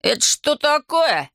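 slow, high-pitched indignant question; aghast, stretching out every word; shocked outrage. A narrator's reading. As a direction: demand an explanation, voice leaping up. Family startled by sudden glass wall.

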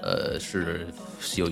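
normal talking voice

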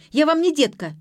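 loud, high-pitched indignant protest; flaring up. A narrator's reading. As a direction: insist hotly that you deserve respect. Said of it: "взвилась Ксения".

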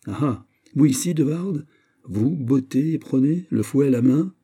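high, astonished questioning; amazed to run into a friend; surprised greeting. Acted. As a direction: react with lively surprise, pitch lifted. Surprised to meet a booted friend.

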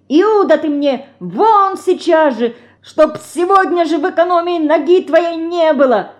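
high-pitched furious banishment; retold angry shouting. Федорка mimics the master banishing Павлуха, angrily shouting. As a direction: rail like an outraged master. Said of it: "кричит".

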